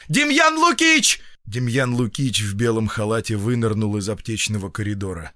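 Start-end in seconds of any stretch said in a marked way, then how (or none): 0.1-1.2 high, drawn-out summoning shout; yell. A narrator's reading. Doctor yelling for his feldsher, alarmed. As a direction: holler for help down the corridor. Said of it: "и завопил".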